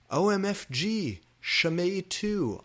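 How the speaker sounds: high, slow excited shriek. A narrator's reading. Heroine learning he's in Slytherin, high excited shriek. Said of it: "I shrieked".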